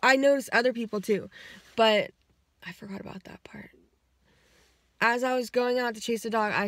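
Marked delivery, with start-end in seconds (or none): 2.6-3.7 quick whispered aside. Real, not acted. Whispering to viewers she forgot a part. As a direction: drop to a fast whisper.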